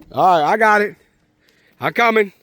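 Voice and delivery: ordinary talk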